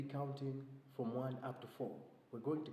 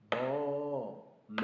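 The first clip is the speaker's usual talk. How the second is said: cow and goat sounds; 8 dB louder than usual. Shown as a demonstration. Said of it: "sound of a cow... goat".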